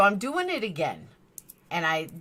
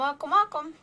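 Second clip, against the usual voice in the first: welcome; 10 semitones higher than usual. Acted. Cheery channel greeting.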